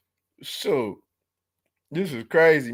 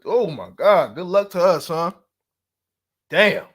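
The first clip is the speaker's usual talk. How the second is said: ordinary delivery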